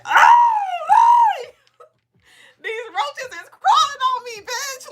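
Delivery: high shrieking panic, half laughing; mock terrified shriek. A contestant squealing about roaches in a box. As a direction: squeal like bugs are crawling on you.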